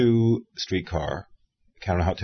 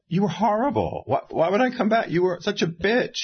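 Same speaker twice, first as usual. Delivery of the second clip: high, incredulous retelling of telling her off; indignant retort; 12 semitones higher than usual. Retold or imitated. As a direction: voice the indignant comeback, pitched way up. Retort to Bacall at a party.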